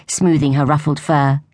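usual speaking voice

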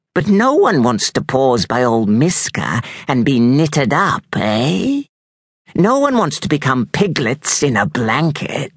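put-on high, menacing old crone; witch voice. A narrator's reading. Witch Miska threatening the children, menacing. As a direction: taunt them like a witch. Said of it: "her voice would tear tin plate".

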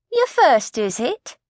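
concerned coat check lady asking a fainting pregnant woman; kindly coat check lady. Acted. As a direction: fuss over her like a kindly auntie.

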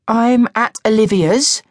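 put-on high, slow, wary young girl. A narrator's reading. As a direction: answer cautiously like a suspicious daughter. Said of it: "Alice said warily".

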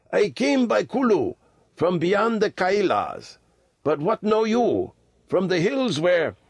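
put-on high-pitched old hill traveller; old lama, wistful. A narrator's reading. Old lama telling boys of his homeland, high and wistful. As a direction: recall far mountains in a high old voice. Lama tells where he comes from.

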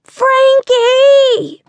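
long, high, loud bellow of the name. A narrator's reading. Girl bellowing for her dog past the neighbours. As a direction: belt the name out down the street. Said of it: "invitation to bellow".

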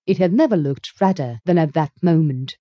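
ordinary delivery

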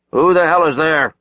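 loud, high, gruff yell through a door; gruff shout. Acted. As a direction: bark it out, suspicious. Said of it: "he heard a gruff voice shout".

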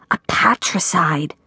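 hushed, deliberate whisper; conspiratorial, voiceless murmur; whispered aside. A narrator's reading. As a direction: whisper the term like a secret. Whispered naming of the crime.

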